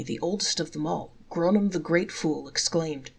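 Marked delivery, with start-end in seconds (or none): none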